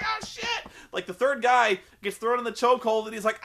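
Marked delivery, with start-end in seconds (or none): 0.0-0.7 very high-pitched yelp; high, hammed-up cry; mock panicked squeal. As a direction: imitate a victim squealing in alarm. Imitating man getting arm broken.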